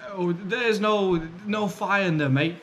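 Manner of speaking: a slow, high, hammed-up Australian accent; bad Australian accent. Imitating an Australian woman at the launch, bad Australian accent. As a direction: put on a clumsy Australian accent, drawn out. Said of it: "that was a bad Australian accent".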